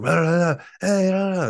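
loud, very high mock foreign babble; sustained high calls in nonsense syllables; imitated foreign shouting. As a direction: mimic rescuers yelling in an unknown language. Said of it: "And then I hear some".

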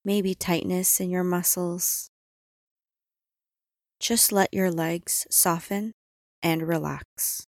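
normal talking voice

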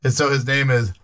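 ordinary talk, nothing marked

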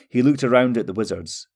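ordinary delivery

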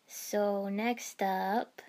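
slow, fading words; trailing off. Acted. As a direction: let it drift away. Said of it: "Kiba trailed off".

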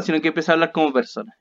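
usual speaking voice

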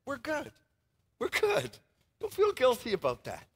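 high, gentle reassurance; lightly voiced Paul reassuring the churches. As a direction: soothe a guilty friend kindly. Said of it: "he says".

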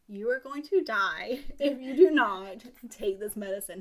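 loud, word-by-word mock warning; emphatic, hammed-up scolding; stern ultimatum. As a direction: hammer out each word like an exasperated nurse. Fairy's exasperated ultimatum.

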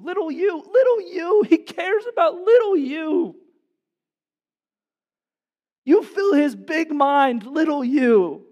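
high, loud, ringing preacher's cry; straining pulpit cry of tender wonder; impassioned cry. Acted. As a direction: cry it out over little you, slow at the end. Climactic cry to each listener.

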